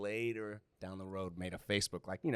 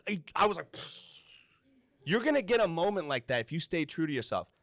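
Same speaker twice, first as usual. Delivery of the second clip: excited; 7 semitones higher, 5 dB louder than usual. Retold or imitated. Excited retold reply to host.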